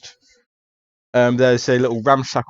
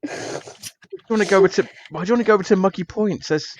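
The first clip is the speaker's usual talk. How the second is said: higher, fast put-upon protest; detective brushing off the case, higher and put-upon; reluctant detective; 4 semitones higher, faster than usual. Acted. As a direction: whine that it's just an accident.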